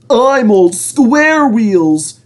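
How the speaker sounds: high, slow, indignant voice; fuming Henry. A narrator's reading. Henry complains to the controller, fuming high and slow. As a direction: fume over the name. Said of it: "fumed Henry".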